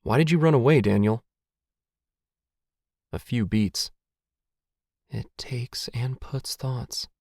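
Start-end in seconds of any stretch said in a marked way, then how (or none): 0.0-1.2 louder, higher appeal; raised, urgent question; insistent questioning. A narrator's reading. Pressing the stranger for answers. 5.1-7.1 very slow, soft, airy words; faint, drawn-out whisper; breathless whisper. A narrator's reading.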